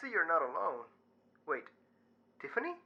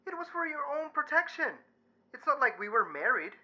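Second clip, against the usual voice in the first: defensive retort; 12 semitones higher, 7 dB louder than usual. Acted. Luis defensive.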